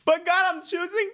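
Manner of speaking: very high, hammed-up wail; mock anguished prayer. Guest acting his prayer to God, mock anguished. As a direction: squeak out a comic plea to God, pausing before the last words. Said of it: "my response was".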